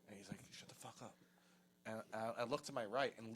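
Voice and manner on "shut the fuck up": retold hushed warning, nearly whispered; urgent hushing. Sal silencing his friend with Lisa right behind, urgently hushed. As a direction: shut someone up without being overheard.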